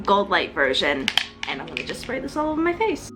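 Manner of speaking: ordinary talk, nothing marked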